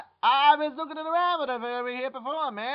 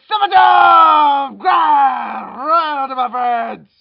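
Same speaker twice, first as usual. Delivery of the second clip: loud, drawn-out holler; big summoning shout; 3 semitones higher, 11 dB louder, slower than usual. Acted. Scene: hero summons friends and hails Jiminy, booming shout. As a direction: bellow it out like a grand summons.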